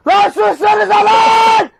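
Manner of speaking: loud, drawn-out yell; angry shout. Real, not acted. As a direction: bellow it at them. Yelling at noisy people.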